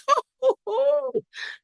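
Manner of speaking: high, gleeful laugh; a squealing burst of laughter; delighted laugh. Real, not acted. Laughing about her Starbucks love.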